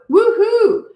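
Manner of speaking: high, loud cheering whoop; yelled woohoo. Retold or imitated. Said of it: "So I yelled out".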